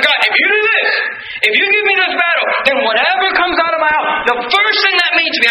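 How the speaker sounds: high-pitched, pressing bargain; bargaining Jephthah. Acted. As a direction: push God for a deal, pitched well up. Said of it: "approaches this thing and says".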